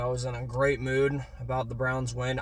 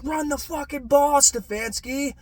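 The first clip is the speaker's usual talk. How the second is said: retold angry yell; 9 semitones higher than usual. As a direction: yell at the TV like the coach can hear. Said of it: "I'm sitting there screaming with my dad".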